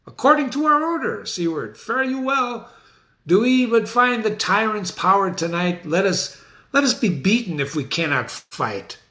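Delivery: high, ringing orders; commanding Malcolm. Acted. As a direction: send the army into battle. Teacher voicing Malcolm commanding army.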